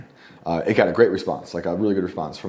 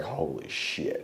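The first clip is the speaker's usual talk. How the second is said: awed whisper; 4 semitones lower, 10 dB quieter, much slower than usual. Retold or imitated. Retelling his reaction to finishing poem.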